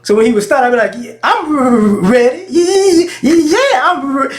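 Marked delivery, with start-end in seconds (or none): 1.0-4.2 high, clownish stammer on repeated sounds; stuck on the first sound, playfully mocking; mocking stutter. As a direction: tease with an exaggerated, high-pitched stutter. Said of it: "respond without stuttering".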